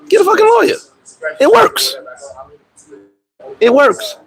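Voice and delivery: selling the point hard; emphatic urging. Real, not acted. Emphatic advice to viewers.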